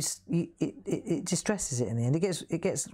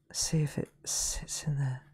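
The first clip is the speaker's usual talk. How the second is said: whisper. Real, not acted. Whispering to herself while painting.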